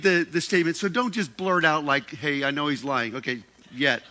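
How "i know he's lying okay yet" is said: normal talking voice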